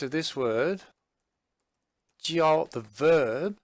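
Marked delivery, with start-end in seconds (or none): 2.2-2.7 "jiao" held high and level, slow; first tone jiao. Shown as a demonstration. Teacher contrasts the tones of jiao, high and level. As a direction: keep "jiao" high and flat. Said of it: "it's actually first tone".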